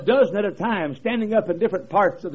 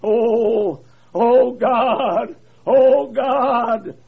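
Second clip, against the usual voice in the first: moaning Oh God; mild groaning prayer; second preacher groaning; 5 semitones higher, 7 dB louder, much slower than usual. Retold or imitated. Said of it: "heard a second preacher".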